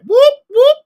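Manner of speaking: loud, high police-siren whoops. Pretend. Joking about police friends, loud siren whoops. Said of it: "That's a sound of the".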